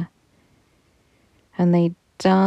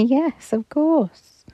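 laughing angels; 8 semitones higher, slower than usual. Acted.

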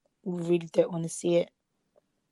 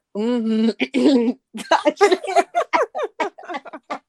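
laughing; 6 semitones higher, 7 dB louder than usual. Real, not acted. Laughs at own words.

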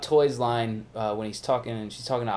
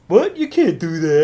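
high, loud indignant outburst; hammed-up disbelief, voice jumping up; mock shocked protest; 6 semitones higher, 10 dB louder than usual. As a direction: imitate a scandalized do-gooder. Acting out the naive good cop.

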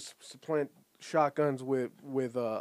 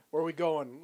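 ordinary delivery

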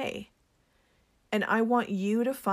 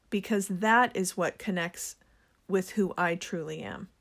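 ordinary talk, nothing marked

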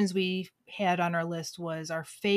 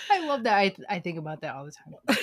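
normal talking voice

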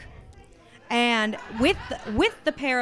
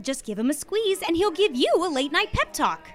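high, bright-sell ad announcer voice; commercial voiceover; 6 semitones higher than usual. Shown as a demonstration. Actress demos her ad read for the host, announcer voice. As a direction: pitch the teddy bear like a TV spot. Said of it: "She's gonna do".

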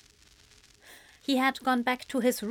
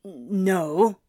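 tense, doubting question; a touch of anger, wavering; anger with uncertainty. A narrator's reading. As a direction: still cross but suddenly unsure. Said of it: "the anger and tension still in his voice".

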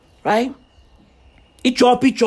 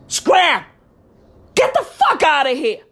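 higher, acted dismissive yell; mock chasing off; 5 semitones higher than usual. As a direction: shoo someone away in mock outrage. Acting out chasing worker off.

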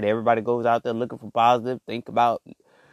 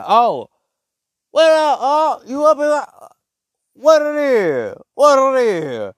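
much higher and louder mock greeting, slowing on what it is; fake hype greeting; 13 semitones higher, 8 dB louder than usual. Mocking people who greet him now that he has clout, loud fake-friendly hype. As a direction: ham up a phony buddy's hello. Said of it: "you be like".

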